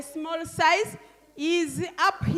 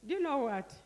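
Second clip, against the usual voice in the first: ordinary talk, nothing marked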